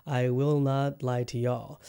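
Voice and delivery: ordinary talk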